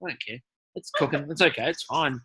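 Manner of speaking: low, soft mock shrug of a reply. Contestant brushing off the judge's smoke warning, mock indifferent and soft. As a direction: toss it off like nothing matters.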